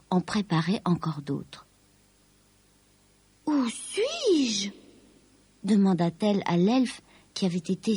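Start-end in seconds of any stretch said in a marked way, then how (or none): 3.5-4.7 higher, drawn-out question; high-voiced puzzled dragon aunt; Lucie, wondering. A narrator's reading. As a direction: ask in a high, wondering character voice. Lucie asks the elf where she is.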